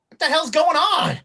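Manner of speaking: high, loud burst of bewilderment; startled and baffled, pitch jumping up; confused. Acted. Game master as a man who sees a gun, confused. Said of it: "he's confused".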